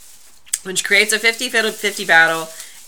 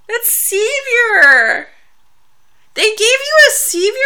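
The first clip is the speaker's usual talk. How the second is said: excited squeal; 14 semitones higher, 5 dB louder, slower than usual. Real, not acted. Reacting to picture.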